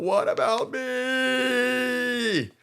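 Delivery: very high, slow drawn-out wail; freaking out. Retold or imitated. Kinta protesting he's left out, long high whine. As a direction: stretch it into a high jealous whine.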